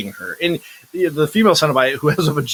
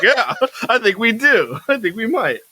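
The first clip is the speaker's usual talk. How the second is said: laughing mock Cenobites; 8 semitones higher than usual. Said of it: "and they're like".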